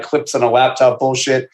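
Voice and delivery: normal talking voice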